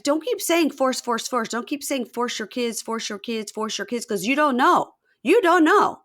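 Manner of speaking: high, repetitive mock rant; aggrieved protest. Husband objecting to talk about his kids, mock outrage. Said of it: "he's like".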